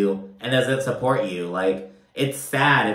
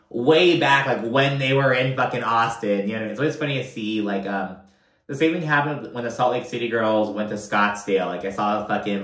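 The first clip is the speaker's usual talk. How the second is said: usual speaking voice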